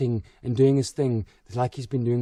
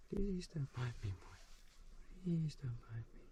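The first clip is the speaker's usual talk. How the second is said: hushed pleading; 2 semitones lower, 16 dB quieter than usual. Real, not acted. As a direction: coax it quietly, afraid of a bite. Stroking the snake's head.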